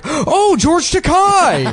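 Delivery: mock-delighted squeal, very high and drawn out; loud happy exclaiming. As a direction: imitate an over-excited fan announcing it to everyone. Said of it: "had to loudly exclaim".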